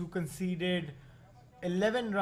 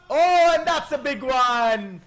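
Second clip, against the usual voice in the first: excited call; 6 semitones higher, 8 dB louder than usual. Real, not acted. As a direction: belt out the big hit. Six called.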